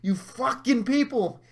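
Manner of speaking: high-pitched exasperated rant; worked-up, indignant outburst; playfully furious. Retold or imitated. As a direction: scold the clueless crowd in mock fury. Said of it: "I get so furious".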